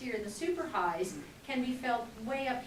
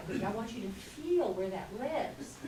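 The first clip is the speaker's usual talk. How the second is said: ordinary talk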